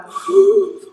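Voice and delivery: loud sharp gasping inhale; the wrong, noisy way to breathe in. Shown as a demonstration. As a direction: demo a noisy gasp of breath. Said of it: "So we don't want".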